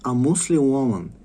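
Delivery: ordinary talk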